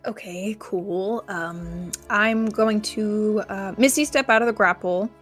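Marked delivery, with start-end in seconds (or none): none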